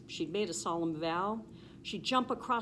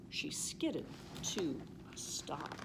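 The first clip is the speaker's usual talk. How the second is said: softer, slow hush; 4 semitones lower, 7 dB quieter than usual. A narrator's reading. Narrator tells of the sudden stop, slow and hushed. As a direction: let it fall quiet and slow. Cow stops at peak.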